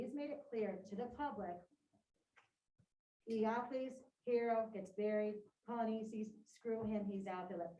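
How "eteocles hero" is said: ordinary talk, nothing marked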